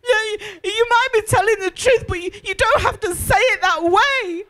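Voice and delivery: half-acted teary complaint; retold tearful protest. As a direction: plead through tears as she did then. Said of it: "And I said to him".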